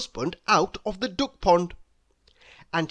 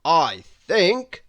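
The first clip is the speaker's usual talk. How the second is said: loud, pointed bird voice; blackbird character; 5 dB louder than usual. Acted. Blackbird to blushing mouse, loud and pointed. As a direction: pronounce it loudly and importantly. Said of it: "continued Charles".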